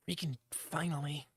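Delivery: whispered, worn-out relief; exhausted whisper. Real, not acted. Streamer whispers relief after the long haul, exhausted whisper. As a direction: sigh it out under the breath.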